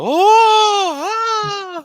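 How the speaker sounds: long, very high, loud scream; crowd screams. Acted. Game master imitates people screaming on the Ferris wheel, loud screams. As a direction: wail like riders on a fairground ride. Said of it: "you just hear people go".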